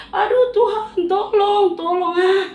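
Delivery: high, loud panicked pleading; frightened cry for help. Acted. Disciples crying out to the Lord, panicked. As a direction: beg for rescue in terror.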